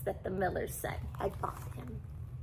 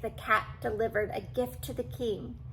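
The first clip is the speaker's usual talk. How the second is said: usual speaking voice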